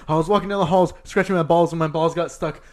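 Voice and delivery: loud, outraged reading of the rhyme; yelling old teacher. Retold or imitated. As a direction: read it back like a scolding old lady. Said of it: "imagine an old lady yelling".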